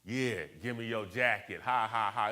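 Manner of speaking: mocking schoolmates' tease, spoken fake laugh; tease, then punch out a loud "ha, ha, ha"; imitated jeering with mock laughter; joking taunt. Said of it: "It was a big joke".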